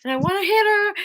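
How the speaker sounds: high-pitched, loud exasperated complaining; annoyed rant. Retold or imitated. Messaging Pinky about a loud lady, high annoyed whine. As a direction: whine it out, fed up with her. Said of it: "messaging Pinky going".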